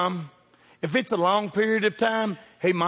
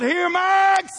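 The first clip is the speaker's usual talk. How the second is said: high, loud shout for the dog; loud call for a lost dog; yelled call; 11 semitones higher, 5 dB louder than usual. Retold or imitated. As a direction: yell into the dark woods. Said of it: "went out there and yelled".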